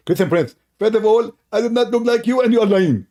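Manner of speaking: high, loud indignant put-on voice; a hammed-up high indignant rival; mock Zakir Naik voice. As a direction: protest shrilly as the accused rival. Mock dialogue, imitating Zakir Naik.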